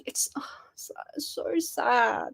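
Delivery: breathy whisper over a sad show; whispered sorrow; sudden whisper. Real, not acted. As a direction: drop to a hushed whisper.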